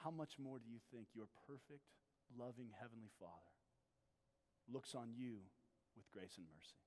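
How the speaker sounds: soft, low pastoral voice drawing the listener in; hushed sermon voice asking the congregation; intimate preaching. Acted.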